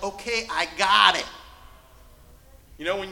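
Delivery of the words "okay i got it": impatient got it. Retold or imitated. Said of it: "I'm like".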